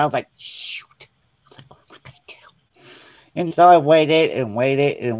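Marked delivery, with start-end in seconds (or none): 0.4-2.3 whispered aside to yourself; real quiet. Retold or imitated. Plotting a spider prank on the sister, whispered real quiet. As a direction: drop to a near-silent whisper. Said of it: "I said it real quiet".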